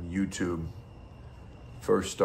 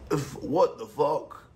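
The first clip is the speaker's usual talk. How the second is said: high, slow, bad Russian accent; attempted Russian accent; 8 semitones higher, slower than usual. Streamer imagining a viewer reacting to his video, clumsy Russian accent. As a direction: do a poor Russian accent, pitched up. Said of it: "trying to do a Russian accent".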